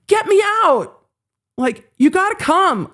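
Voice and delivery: high, pleading cry for rescue; begging from prison in a high mock panic; desperate plea.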